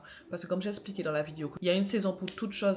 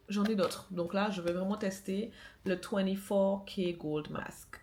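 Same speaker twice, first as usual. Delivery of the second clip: usual speaking voice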